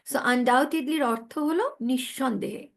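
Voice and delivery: usual speaking voice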